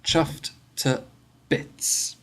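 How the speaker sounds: Chuffed to bits with to said as a bare t; slow, careful Chuffed to bits, to as t; to reduced to t. Shown as a demonstration. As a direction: drop the vowel of to, just t. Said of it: "we say t".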